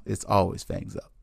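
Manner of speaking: usual speaking voice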